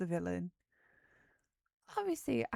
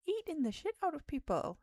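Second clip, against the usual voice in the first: excited; 10 semitones higher, 3 dB louder than usual. Real, not acted. Dramatic high burst.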